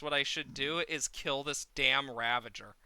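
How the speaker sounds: normal talking voice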